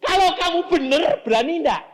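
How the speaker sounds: high, loud preacher's dare; shouted challenge. Acted. Preacher daring the congregant to be brave, shouted challenge. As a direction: throw the question out at full force.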